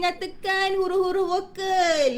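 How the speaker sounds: high, level drawn-out classroom command; drilling teacher. Shown as a demonstration. Teacher quizzing child on vowel letters, drilling call. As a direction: call it out like a rote quiz. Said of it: "tanya anak macam tu kan".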